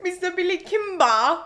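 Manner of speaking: "Kimber" clipped with the -er swallowed; Billy Kimber impression. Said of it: "Just try to do his thing".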